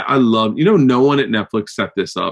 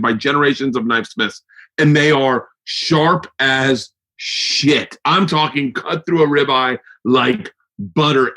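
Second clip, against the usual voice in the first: higher, upbeat sponsor pitch with slowed key phrases; ad read; 4 semitones higher than usual. Acted. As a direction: sell the knives like a pumped host. Reads a sponsored ad.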